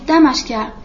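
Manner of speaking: plain reading voice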